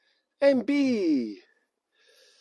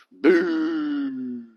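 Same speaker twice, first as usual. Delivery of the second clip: mock boo; 4 semitones lower, 4 dB louder than usual. Viewer is from England, mock boo.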